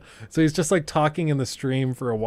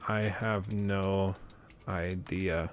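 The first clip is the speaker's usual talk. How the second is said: slow, soft, dragged-out words; guessed gamer quote; 5 semitones lower, 9 dB quieter, much slower than usual. Retold or imitated. Gamer telling XQC he can't follow him, slow and soft. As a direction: drawl it like a baffled gamer. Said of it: "Maybe something like that".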